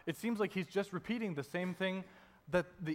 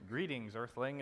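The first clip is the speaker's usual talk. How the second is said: stiff alien greeting; 5 semitones lower, 5 dB quieter than usual. Retold or imitated. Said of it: "It's not like that".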